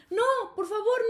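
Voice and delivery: high, loud begging; acting out a desperate plea; mock pleading. Caught boy begging his teacher, high mock plea.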